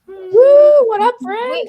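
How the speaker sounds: high, loud whoop into a hello; excited greeting. Real, not acted. Greeting a friend on the panel, excitedly whooping.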